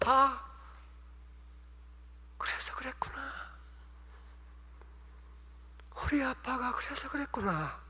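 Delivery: hushed whisper of sudden understanding; dawning realization. Retold or imitated. Daughter realizing why her father acted so, whispered. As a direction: breathe it out as the truth sinks in.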